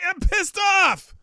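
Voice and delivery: hammed-up angry host shout; pissed off. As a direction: bellow the complaint like a catchphrase. Ranting host opens show.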